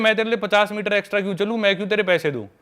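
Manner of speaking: mock her as if offended by the request; mock indignant girl. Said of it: "मतलब वो लड़की बोल रही है".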